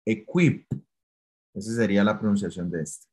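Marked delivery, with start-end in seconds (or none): 0.0-0.7 "Equip" with a crisp, exaggerated final p; overdone equip. Shown as a demonstration. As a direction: overact the end of "Equip", pop the p. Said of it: "yo les invito a que lo sobreactúen".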